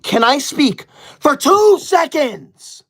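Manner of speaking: loud, high and very slow; exasperated slow plea. Real, not acted. Exasperated after repeated gifts.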